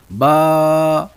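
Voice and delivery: با said loud with a full, heavy mouth. Shown as a demonstration. Arabic teacher demonstrating the heavy letter با to students, loud and held. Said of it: "اس کی آواز موٹی ہے".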